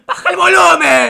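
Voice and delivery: high, drawn-out shout with laughter; mock neighbour yell. Host acting out a complaint to noisy neighbours, loud mock yell. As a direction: holler it slowly across to next door.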